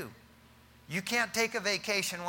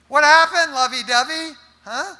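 loud, high-pitched teasing call; mock taunting; 8 semitones higher, 14 dB louder than usual. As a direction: call out a loud mocking question. Teasing a couple gone cold.

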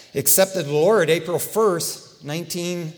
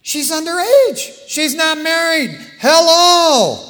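outraged exclamation; 11 semitones higher, 11 dB louder, slower than usual. Real, not acted. Outraged preacher protesting.

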